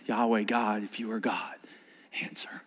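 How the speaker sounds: very soft, low prayer; hushed prayer. Acted. Elijah calling on God, very soft prayer. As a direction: pray almost silently.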